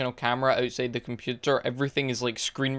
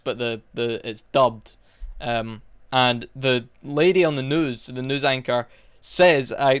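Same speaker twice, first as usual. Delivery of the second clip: normal talking voice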